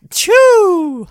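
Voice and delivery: huge, high imitated achoo; exaggerated sneeze. Imitating people who sneeze far too hard, explosive. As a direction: explode into an over-the-top sneeze. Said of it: "some people, they'll sneeze".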